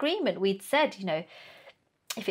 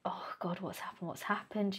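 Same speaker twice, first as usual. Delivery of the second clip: hushed worry; 10 dB quieter than usual. Retold or imitated. Retells worry at Laura's crying call.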